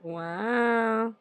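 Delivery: normal talking voice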